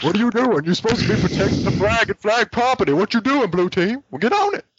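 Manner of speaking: high put-on scolding voice; scolding announcer. Alternate announcer scolding blue team, high mock outrage. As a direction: tell the team off in a high character voice.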